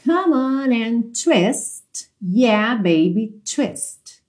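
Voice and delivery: slow, drawn-out words; slow model lyrics. Shown as a demonstration. Teacher modelling the last lyrics for students, slow and drawn out. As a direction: separate each phrase and stretch it.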